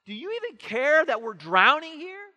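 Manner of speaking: high, loud, exasperated cry; panicked disciples. Retold or imitated. Disciples shouting at sleeping Jesus, high and loud. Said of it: "we're like".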